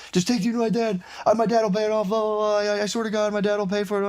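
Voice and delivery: high, whining begging voice; whiny pleading kid. Retold or imitated. Kid begging to be taken to his dad, high whine. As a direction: beg like a scared kid. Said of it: "And he's like".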